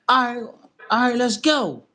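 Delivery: fired up to take the fight; excited agreement. Retold or imitated. Retold acceptance of fight offer.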